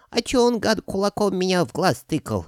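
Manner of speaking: very high, indignant creature voice; indignant dragon head. A narrator's reading. Middle head protesting about the knight, high and indignant. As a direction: protest in a piping falsetto. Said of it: "возмутилась средняя".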